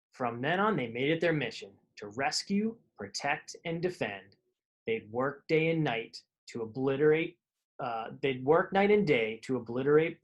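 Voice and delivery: put-on lively read-aloud for kids; storybook reading. A narrator's reading. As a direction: tell the rhyming tale to children. Reading picture book aloud.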